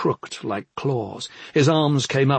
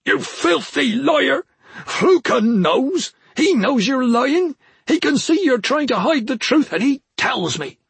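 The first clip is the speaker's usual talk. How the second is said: furious accusation; 13 semitones higher, 4 dB louder than usual. A narrator's reading. Deluded brother calling his sister a liar, ranting furiously. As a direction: rage at her, stretching the key words.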